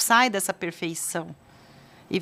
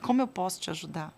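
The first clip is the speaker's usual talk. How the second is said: soft, lowered, almost whispered question; gentle offer; 5 dB quieter than usual. Retold or imitated. Parent offering comfort instead of solutions, gently. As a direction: ask softly, making room for an answer.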